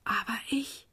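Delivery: soft, breath-only whisper; hushed little whisper. A narrator's reading. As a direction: whisper it faintly, as a weak child. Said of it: "flüsterte das kleine Gänseblümchen".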